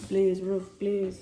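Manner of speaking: loud, playful begging; mock pleading. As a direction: beg the thing to behave. Begging the falling roof.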